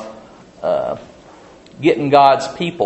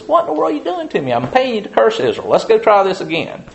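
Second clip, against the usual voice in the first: annoyed king; 9 semitones higher, faster than usual. Retold or imitated. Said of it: "The king says".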